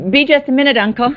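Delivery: high-pitched call to someone behind her; calling back. Acted. Niece tells uncle to wait, calling back. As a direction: call it lightly over your shoulder.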